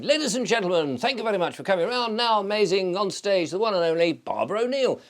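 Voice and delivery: high, fast showman's introduction; big stage compere bringing on the star; compere announcing. Retold or imitated. Compere introducing Barbara O'Neill to the summit crowd, showman voice. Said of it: "I was the master of ceremonies".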